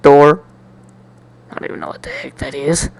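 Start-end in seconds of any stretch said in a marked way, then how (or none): 1.5-2.9 fast, soft whispered aside; a quick, breathy under-the-breath aside. Real, not acted. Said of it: "aside whisper".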